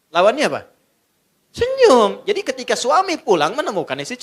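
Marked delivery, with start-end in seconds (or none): 1.5-2.2 much higher and louder single word; emphatic answer. Acted. Preacher tells the congregation the answer loudly. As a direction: sell the word as a punchline.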